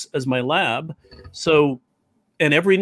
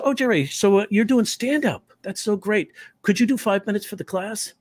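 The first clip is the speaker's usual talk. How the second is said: higher, teasing teacher voice; teacher's voice; 6 semitones higher than usual. Retold or imitated. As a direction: play the sweet acting teacher. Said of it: "she goes".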